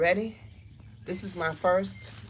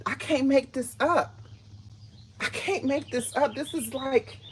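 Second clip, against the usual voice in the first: much higher, excited disbelief; amazed; 8 semitones higher than usual. Real, not acted. Overwhelmed by melons growing.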